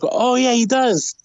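high-pitched imitation of a delighted daughter; amused realisation. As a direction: pitch it way up, like a kid suddenly seeing it.

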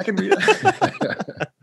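high, slow, laughing repeat; laughing echo. Retold or imitated. Host echoes the guest through laughter. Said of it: "laughs That's awesome".

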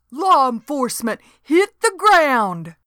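a panicky rookie squeaking orders; young deputy squeaks at the ladies, high and loud; squeaked order. A narrator's reading. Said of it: "he squeaked".

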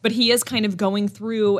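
ordinary talk

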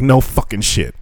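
usual speaking voice

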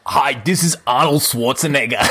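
put-on Austrian accent broken by a laugh; giggling through an Austrian Arnold accent; Arnold Schwarzenegger impression. Podcast host pleads for reviews in laughing Austrian accent. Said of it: "let Arnold come in".

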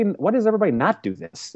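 wondering why nobody else does it; puzzled questioning. Retold or imitated. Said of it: "And then I started questioning".

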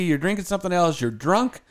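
normal talking voice

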